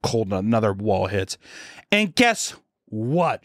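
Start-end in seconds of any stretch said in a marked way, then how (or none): 1.9-3.4 very slow, drawn-out GUESS WHAT, pitched up; imitating teacher. Health teacher teasing the next revelation, very slow mock instructive voice. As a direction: stretch "guess what" into a long announcement. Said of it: "She says that".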